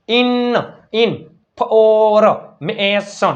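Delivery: ઇન, ફોર, મેસન in slow separate chunks, ફોર held loud and long; sounded-out demo. Shown as a demonstration. Teacher spelling from pronunciation, chunks ઇન ફોર મેસન drawn out. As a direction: break the word into ઇન... ફોર... મેસન, stretching each vowel. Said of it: "ઉચ્ચાર ઉપરથી spelling".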